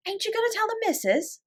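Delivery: high pleading servant voice; a scared scullery girl begging; timid maid. A narrator's reading. Becky fears punishment.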